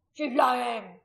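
loud, strained protest; crying out. A narrator's reading. Held man defending the woman, crying out. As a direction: shout the denial over the crowd. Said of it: "Atreides cried out".

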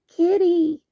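high, loud delighted call; happy toddler cry. A narrator's reading. Boo calls Sully again, high and loud. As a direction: call out like an excited little child. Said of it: "the ghost cried again".

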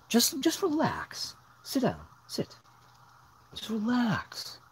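high, slow, gentle pet voice; high, slow coaxing to a dog; soothing the dog. Real, not acted.

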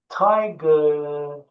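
tiger said clearly as "tai-ger"; tiger, spoken for learners; careful pronunciation. Shown as a demonstration. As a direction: dikkatli telaffuz. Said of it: "Okunuşuma dikkat et".

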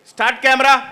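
loud director's call; calling the take. Acted. Director to crew starting a take, calling out. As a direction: call out to roll camera.

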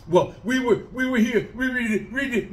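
mocking whiny guy. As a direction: parody him as a dim, stammering excuse-maker. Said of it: "he's like".

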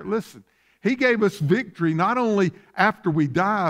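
usual speaking voice

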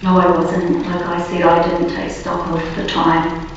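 ordinary delivery

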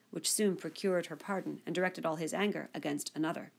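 plain reading voice

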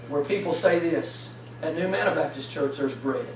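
quiet earnest preacher leaning in close; hushed preaching. Acted.

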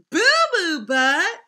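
loud high incredulous repeat; mock disbelief.

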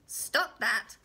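loud little-man interruption; sharp command. Acted. Little man interrupts the singing mother, sharply. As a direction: cut in hard and loud.

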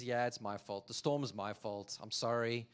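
low, soft, guilty admission; sheepish confession. Acted. Jonah admitting to the captain that the storm is his fault, low and sheepish. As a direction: own up quietly, head down.

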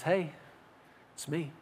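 very soft, gentle call; hushed inner voice; still small voice. Acted. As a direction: say it quietly, with a pause after the first word. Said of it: "small voice on the inside".

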